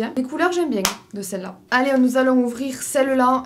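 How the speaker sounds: normal talking voice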